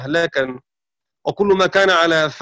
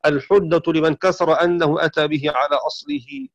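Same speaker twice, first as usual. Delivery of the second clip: usual speaking voice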